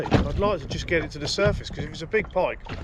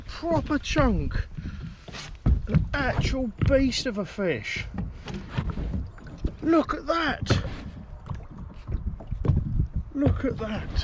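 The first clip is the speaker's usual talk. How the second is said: high, slow, awestruck; amazed awe; 8 semitones higher, slower than usual. Real, not acted. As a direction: marvel at it, drawn out. Admiring the huge catch.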